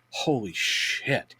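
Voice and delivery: slow, soft awed hush; stunned under breath. Retold or imitated. Fan spotting George Lucas on the lobby couch, awed hush. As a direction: mutter it in disbelief. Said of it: "sitting there going".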